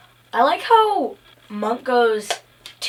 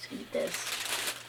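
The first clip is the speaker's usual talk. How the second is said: soft whispered aside; slow, breathy whisper to the listener; 15 dB quieter, slower than usual. Real, not acted. Conspiratorial aside about the food.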